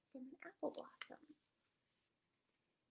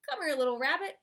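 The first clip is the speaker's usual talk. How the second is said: groundhog; 12 dB louder, faster than usual. Acted. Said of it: "he said".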